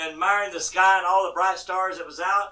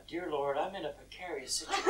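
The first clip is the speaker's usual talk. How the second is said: soft, unemotional mock prayer; mock quiet prayer; 3 semitones lower, 12 dB quieter than usual. Mocking a reserved man praying from the cliff, hushed. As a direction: pray it politely, far too softly. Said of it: "I don't really get emotional".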